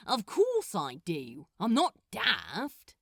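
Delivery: snapped. Acted. Crowley snapping at Gabriel's smug question. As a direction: bite back, offended. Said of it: "snapped Crowley".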